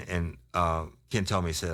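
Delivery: usual speaking voice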